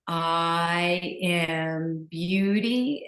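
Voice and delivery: slow, drawn-out affirmation on the in-breath; soft, slow mantra. Shown as a demonstration. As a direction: stretch each word along with the breath. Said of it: "I'll share with you what you can also do is you can breathe in".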